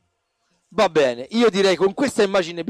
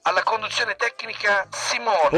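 announcer voice. Acted. Reads the podcast outro credits.

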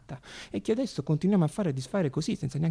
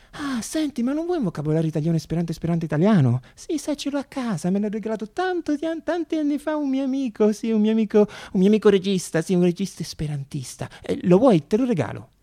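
voce acuta e sopra le righe; attrice teatrale; 7 semitones higher, 6 dB louder than usual. Retold or imitated. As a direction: fai l'attrice esuberante e invadente. (l'attrice sull'autobus, acuta ed espansiva)

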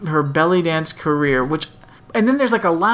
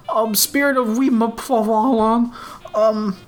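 high, odd-voiced awkward fan; weird woman voice; 6 semitones higher than usual. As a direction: ask it in a strange high voice. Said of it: "she has a weird voice".

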